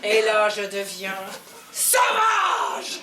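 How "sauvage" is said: wild cry. Acted. Becoming wild.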